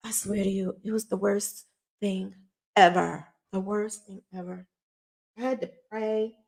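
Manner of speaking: soft, shaken confession; hushed sincerity. Real, not acted. Woman swears it was the worst thing ever, hushed and earnest. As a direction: drop to a quiet, solemn near-murmur.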